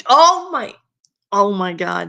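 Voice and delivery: shocked. Real, not acted. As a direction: blurt it out in shock. Shocked reaction to fanfic.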